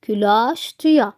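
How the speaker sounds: very slow, every syllable clear; slow model phrase for learners; slow reading aloud. Shown as a demonstration. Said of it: "زي". (قراءة بطيئة واضحة)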